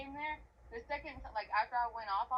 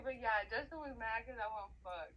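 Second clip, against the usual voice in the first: ordinary talk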